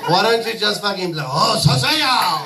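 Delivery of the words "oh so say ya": slow, hammed-up mock Japanese accent, laughing; mocking Japanese accent, slowed and shouted. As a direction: drag it out in a cartoonish Japanese accent.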